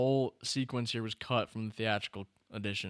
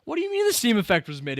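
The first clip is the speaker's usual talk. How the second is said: high, fast indignant disbelief; incredulous; 10 semitones higher, 6 dB louder, faster than usual. Real, not acted. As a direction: fire back in disbelief. Arguing with chat.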